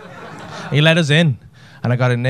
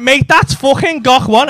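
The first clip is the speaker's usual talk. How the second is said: excited insistence; 10 semitones higher, 6 dB louder, slower than usual. Retold or imitated. Louder insisting again.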